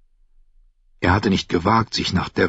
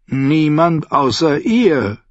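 higher, slow and weighty trader voice; slow Lyseni captain; 8 semitones higher, 4 dB louder, slower than usual. Acted. Salladhor telling Davos who the king sees, slow and grave. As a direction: let the name hang darkly.

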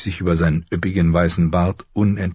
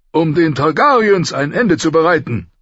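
loud, much higher angry king; growling king; 11 semitones higher, 5 dB louder than usual. Acted. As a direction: snarl at Ned. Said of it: "knurrte der König". (knurrender König, laut)

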